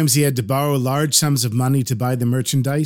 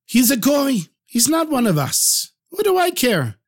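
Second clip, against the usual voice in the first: scoffing husband; 9 semitones higher than usual. Acted. Husband dismissing wife's objection.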